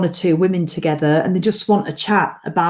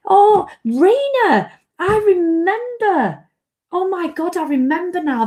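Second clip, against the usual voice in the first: high, drawn-out cry of recognition; sudden delighted realisation; 11 semitones higher than usual. Real, not acted. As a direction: stretch the words high as the memory comes back. Suddenly recalls, thanks to Rena.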